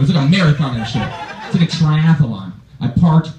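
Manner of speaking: ordinary talk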